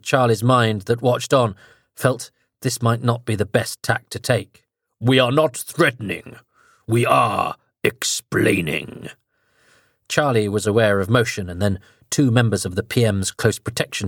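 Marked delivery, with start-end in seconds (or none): 5.0-9.1 very slow, low, growling alien voice; growling deeper voice. Acted. Possessing entity speaks through Charlie to the Prime Minister, slow menacing growl. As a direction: let something else speak through you, slow and low. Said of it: "growling edge to it and a much deeper register".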